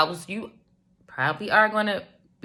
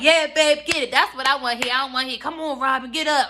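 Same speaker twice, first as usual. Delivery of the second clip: mock eager husband; 6 semitones higher, faster than usual. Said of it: "he can be like".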